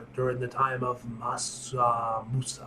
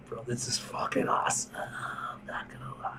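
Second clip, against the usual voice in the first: real whisper; 3 semitones lower, 7 dB quieter than usual. Real, not acted. Awed aside to viewers.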